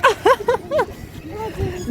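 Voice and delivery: high startled oh then laughter; playful surprise, laughing; surprised laugh. Real, not acted. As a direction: react with a delighted gasp and laugh. Kids bump at slide bottom.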